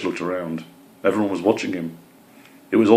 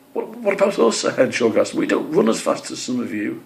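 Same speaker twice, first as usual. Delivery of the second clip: much higher, fretful little hedgehog; worried hedgehog; 6 semitones higher than usual. Acted. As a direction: squeak it out anxiously. Hedgehog voiced in story.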